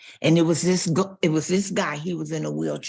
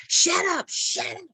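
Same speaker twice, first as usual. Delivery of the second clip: hollering at the dog; 11 semitones higher than usual. Retold or imitated. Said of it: "he began to holler at the dog".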